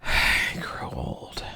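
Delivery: slow unvoiced hush; weary whisper. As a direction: let it fade to a breath, slowly. Repeated line, hushed.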